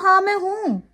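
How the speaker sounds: high, bright yes-I-am; playfully owning up to being called crazy; cheerful admission. Retold or imitated. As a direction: own it proudly and gladly. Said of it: "मैं कहती हूँ".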